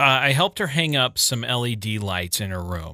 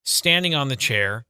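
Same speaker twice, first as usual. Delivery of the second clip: usual speaking voice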